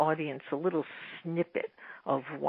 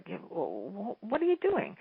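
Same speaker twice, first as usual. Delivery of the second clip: stammered, rising in pitch in surprise; puzzled question; 4 semitones higher, 5 dB quieter, slower than usual. Retold or imitated. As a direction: ask the neighbour what on earth she's doing. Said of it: "I said to her".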